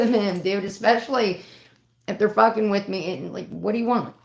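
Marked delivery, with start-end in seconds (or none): none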